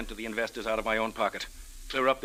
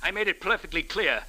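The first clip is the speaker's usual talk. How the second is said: angry; 6 semitones higher than usual. Acted. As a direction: insist hard, voice up. Husband insists angrily.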